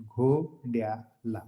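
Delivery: "घोड्याला" drawn out very slowly, part by part; broken into parts. Shown as a demonstration. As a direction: stretch "घोड्याला" out slowly to show the ending. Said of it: "त्याला सामान्य रूप म्हणतात". ("घोड्याला" तुकडे पाडून, अगदी सावकाश)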